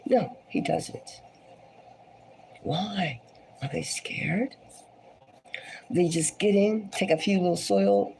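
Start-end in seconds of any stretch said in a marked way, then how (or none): 2.6-4.5 soft eerie whisper; hushed whisper. Real, not acted. Host spooking viewers, whispering. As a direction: whisper it like a ghost story. Spooky question about aliens.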